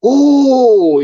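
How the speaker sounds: loud, high drawn-out "ooh"; high mock cry after a tumble; retold "ooh". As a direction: imitate a crash victim's long ooh. Retold groan after a bike crash.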